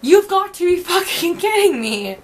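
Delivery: outraged you've-got-to-be-kidding; retold exasperated outburst at the staff; incredulous. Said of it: "And I was like".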